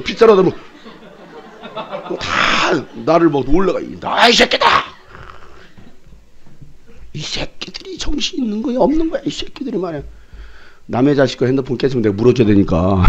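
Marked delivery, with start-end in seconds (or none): 4.0-4.7 angry outburst. Retold or imitated. Angry shout retold. 7.1-10.0 high-pitched scolding. Retold or imitated. Retold scolding of boys.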